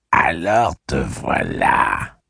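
put-on slow, sly old hag greeting; creepy welcoming crone. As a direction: drawl it with a horrid grin. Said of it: "dit la vieille avec un atroce sourire".

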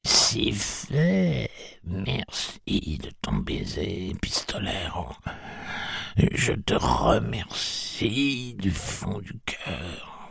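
put-on slow, hushed old woman's whisper; a frail blind woman whispering her fear. A narrator's reading. Blind Mercy whispers her fears to Roland, slow.

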